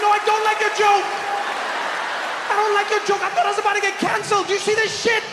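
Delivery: high-pitched indignant shouting; outraged. Acted. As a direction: yell your objection, voice pitched right up. Outraged at waiter's prank.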